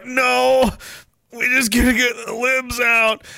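high, mock-strained insistence; strained denial. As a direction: play someone coping through obvious strain. Acts out bro straining in denial.

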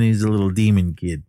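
usual speaking voice

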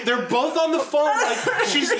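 normal talking voice

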